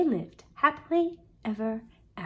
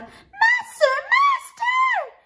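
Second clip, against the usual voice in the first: very high, loud alarmed cry; harp crying out; 7 dB louder, much slower than usual. Acted. Harp cries out to wake the ogre, alarmed. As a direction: shriek for the master to wake. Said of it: "the harp called out".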